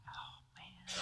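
breathy whispered oh man, deflated; whispered groan. Retold or imitated. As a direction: sigh it out under your breath. Flight cancelled, exasperated aside.

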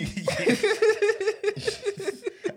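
high loud burst of laughter. Real, not acted. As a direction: crack up loudly.

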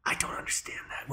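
muttered in a whisper; whispered aside. Real, not acted. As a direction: throw it away as a whisper.

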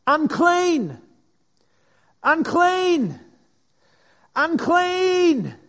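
high, loud repeated warning cry; calling out. Retold or imitated. Leper warning passers-by, loud high cry. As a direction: shout it from the top of the voice like a leper. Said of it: "call out to you from the top of his voice".

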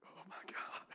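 a breathless whispered aside; hushed whisper. Real, not acted. Preacher overwhelmed mid-sermon, whispered. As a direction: let it out under your breath.

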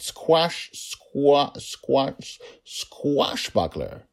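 "Squash, squa-, squash" in high, separate attempts, then a slow "squashbuckler"; halting tries at "squashbuckler", stretched on the last; trying out a word. A narrator's reading. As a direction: test "squashbuckler" piece by piece with pauses. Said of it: "he tried out".

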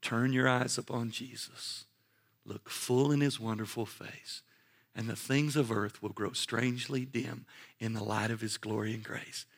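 softly spoken song words; quiet, reverent recollection; tender hymn recitation. Retold or imitated. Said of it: "the words of this old song came to my heart".

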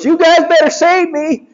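high cocky cartoon hero voice; high smug hedgehog hero; Sonic's voice. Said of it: "And Sonic goes".

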